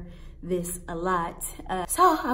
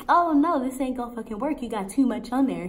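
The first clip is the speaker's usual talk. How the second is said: high, loud, rushed objection; alarmed protest; 4 semitones higher, 5 dB louder than usual. Retold or imitated. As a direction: object quickly in rising alarm. Alarmed protest at overfilled mouth guard.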